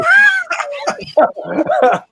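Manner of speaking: high, loud burst of laughter. Real, not acted. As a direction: crack up loudly. Laughing at friend's big head.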